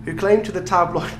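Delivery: usual speaking voice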